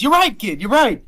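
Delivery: loud, high burst of agreement; excited game character. Acted. Game master as Cade answering the kid's reminder, excited shout.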